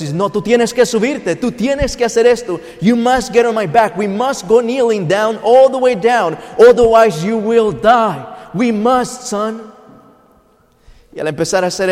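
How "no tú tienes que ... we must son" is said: put-on father to young son on fulfilling the vow, raised, urgent pleading; higher, louder, insistent imitation of a father. Father insisting his son climb on his back, forceful higher-pitched pleading. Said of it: "con mi padre rogándome".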